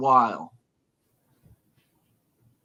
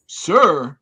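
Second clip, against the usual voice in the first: offended question; 3 semitones higher, 8 dB louder than usual. Retold or imitated. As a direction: shoot it back offended. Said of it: "and she".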